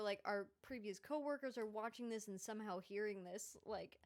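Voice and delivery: ordinary talk, nothing marked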